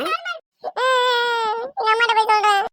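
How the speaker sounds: high, loud tearful wail; mock crying. Girl threatens to tell her mother, crying loudly. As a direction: wail it out like a child running to tell. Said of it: "அழுதுகிட்டே போகுது".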